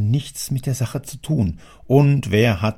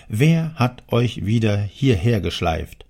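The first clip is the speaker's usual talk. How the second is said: ordinary delivery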